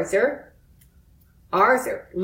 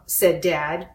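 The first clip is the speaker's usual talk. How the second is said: ordinary delivery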